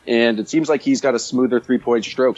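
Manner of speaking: normal talking voice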